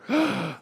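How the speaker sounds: sharp gasp for breath; gasp for air. Retold or imitated. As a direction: gasp like you're drowning. Covid patient gasping for air.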